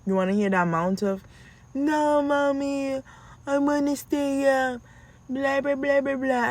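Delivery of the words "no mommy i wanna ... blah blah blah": high put-on little-kid voice; protesting child. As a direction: imitate a child pleading to stay up. Imitates children protesting bedtime.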